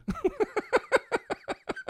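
shrieking giggle; high squealing laugh. Real, not acted. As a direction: burst into a high-pitched laugh. Burst of laughter at joke.

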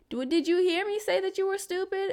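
high, exasperated, disbelieving question; exasperated retort. Retold or imitated. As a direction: throw the question back at him, fed up. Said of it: "I'll be like".

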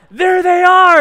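a loud, high whoop of discovery; excited kids' shout. Retold or imitated. Kids in the car spotting the woman, a loud high excited shout. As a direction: yell it like kids who just found the target.

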